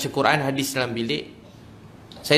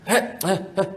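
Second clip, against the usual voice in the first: quick, higher wordless huffs, as if at a cat; imitated shooing grunts; 5 semitones higher than usual. Son mimics his father of few words, curt shooing grunts. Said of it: "dia macam halau kucing je".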